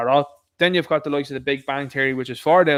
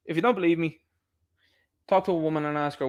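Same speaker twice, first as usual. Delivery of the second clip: usual speaking voice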